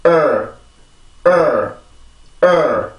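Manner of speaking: 으 as English "er", tongue tip curled slightly; isolated English "er" sound; r-colored schwa. Shown as a demonstration. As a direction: say 으 as a schwa gliding into R, jaw still. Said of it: "알 발음을 할 때 이렇게 들리면서".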